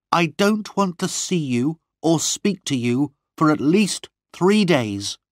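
high, firm angry dismissal; contained anger. A narrator's reading. Lorna banishing Gwenny for days, contained anger. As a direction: banish her with controlled anger. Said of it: "her voice full of quiet anger".

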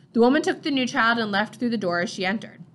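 ordinary delivery, nothing marked

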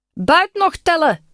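put-on high, drawn-out child's call. A narrator's reading. Boy pirate calls that loot needs counting, high drawn-out call. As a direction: call it out slowly on a high pitch. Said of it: "riep hij".

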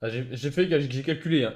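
ordinary talk, nothing marked

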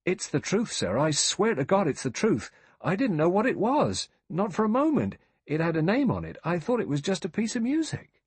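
high, urgent, emphatic protest; crying out. A narrator's reading. Rogers swears to the judge he didn't know, crying out. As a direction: swear it in a high-pitched cry. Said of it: "Rogers cried".

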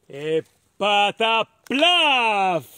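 loud, slow drawn-out splat; splattering cow pat. Acted. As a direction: stretch out the big splat. Cow pat hitting the ground.

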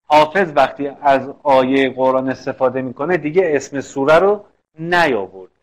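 ordinary talk

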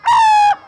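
loud, very high cry; high squeal. A narrator's reading. Animals stopping before the lion, high squeal. Animals all stop in fright.

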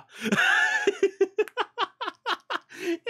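long high burst of laughter; big laugh. Real, not acted. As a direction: crack up at the memory. Laughing at memory.

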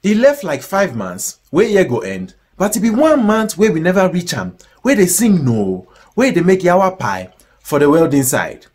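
normal talking voice

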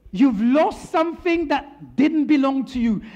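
normal talking voice

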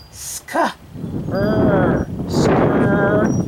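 SK, ER, then SKR, stretched sound by sound. Shown as a demonstration. Teacher helps a child decode a word, sounding it out. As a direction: blend "skr" slowly from its separate sounds. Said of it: "What's this word?".

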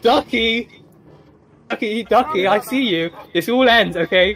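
rally for the final fight; hyped. Real, not acted. Gamer confronting Ducky at his base, hyped.

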